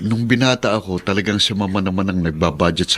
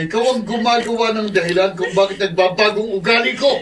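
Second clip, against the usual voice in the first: laughing taunt; 12 semitones higher than usual. Acted. Husband's cruel taunting laugh.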